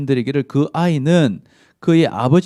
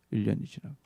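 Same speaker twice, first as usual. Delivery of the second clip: 일 년이 지나고 repeated much softer and lower; fading repeat; 4 semitones lower, 13 dB quieter, faster than usual. Real, not acted. Preacher letting the passing year fade away. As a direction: let 일 년이 지나고 trail off softly.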